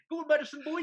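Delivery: high, loud mock touchline shout; West Country accent. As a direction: bellow like a West Country coach. Mocking manager touchline shouts.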